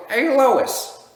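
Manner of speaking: high, loud cartoon dad squeal; Peter Griffin voice.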